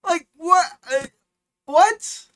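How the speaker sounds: high-pitched, sputtering incredulity; squeaky bewildered question; baffled disbelief. Real, not acted. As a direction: stammer it out, utterly confounded. Baffled by the message.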